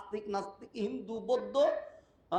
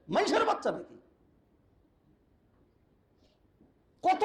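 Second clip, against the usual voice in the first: higher and louder, thundering; fiery preaching; 8 semitones higher, 3 dB louder than usual. Real, not acted. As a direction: preach hard at the crowd. Preaching at full force.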